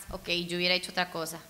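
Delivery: ordinary talk, nothing marked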